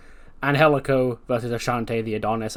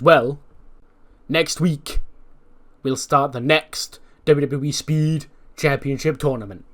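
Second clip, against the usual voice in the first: interviewer in a lightly put-on American accent, a bit higher; 3 semitones higher, 5 dB louder, slower than usual. Funaki announcing the tournament to the champion in a light American accent. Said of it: "giving him the American accent".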